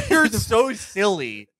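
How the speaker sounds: normal talking voice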